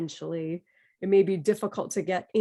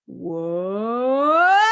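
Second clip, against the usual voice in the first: sound-check sweep, low rising to a squeaky top; low to high sweep; 7 semitones higher, 5 dB louder than usual. Shown as a demonstration. Teacher demos the sound check, rising from low to high. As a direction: slide from low up to very high and louder. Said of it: "start low pitch and raise".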